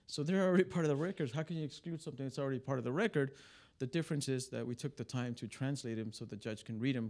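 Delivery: ordinary talk, nothing marked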